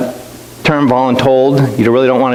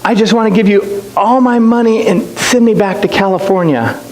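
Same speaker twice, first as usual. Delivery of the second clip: higher, mock tearful child's plea; imitating a crying child; 6 semitones higher than usual. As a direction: lightly voice the sobbing little girl, pitched up. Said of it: "my youngest daughter is just bawling".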